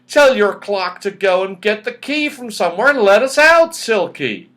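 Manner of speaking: bursting with hopeful glee; joyful. A narrator's reading. Moonface urges Silky to send the clock, joyful and loud. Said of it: "his big face glowing red with joy".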